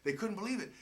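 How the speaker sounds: usual speaking voice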